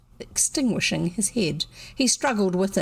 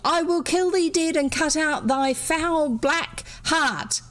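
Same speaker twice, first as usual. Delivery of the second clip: shouting stage villain; 8 semitones higher than usual. A narrator's reading. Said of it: "Douglas was shouting".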